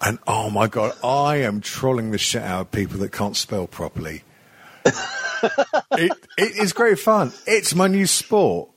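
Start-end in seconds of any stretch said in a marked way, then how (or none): 4.8-8.7 laughing. Real, not acted. Gleeful about trolling.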